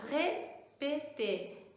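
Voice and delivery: very slow "répéter", ré held apart from péter; "répéter" syllable by syllable with closed ay vowels; broken-down closed É. Shown as a demonstration. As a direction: stretch and split "répéter" to show the closed é. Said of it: "esse som ré".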